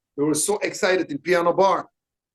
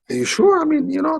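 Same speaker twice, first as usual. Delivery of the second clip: mock-anxious Jean-Claude, pitched much higher and louder; worried Jean-Claude; 11 semitones higher, 4 dB louder than usual. Imitating his nervous friend doubting the plan, high and anxious.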